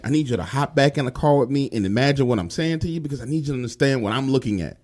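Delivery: ordinary delivery, nothing marked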